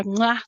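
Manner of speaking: blown kiss. Real, not acted. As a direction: send the viewers a kiss sound. Blowing a kiss in sign-off.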